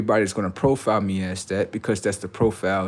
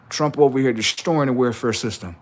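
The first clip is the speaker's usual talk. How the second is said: normal talking voice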